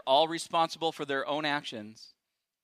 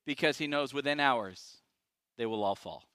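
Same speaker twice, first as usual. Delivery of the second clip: ordinary delivery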